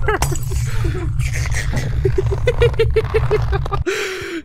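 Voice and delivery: long high-pitched laughing fit; helpless high laugh after a scare; laughter. Real, not acted.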